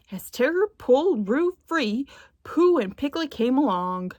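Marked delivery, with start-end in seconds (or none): none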